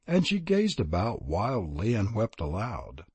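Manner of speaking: ordinary delivery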